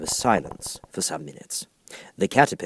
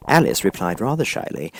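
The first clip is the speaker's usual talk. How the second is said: plain reading voice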